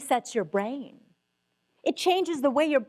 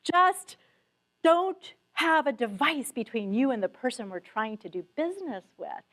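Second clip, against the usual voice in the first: normal talking voice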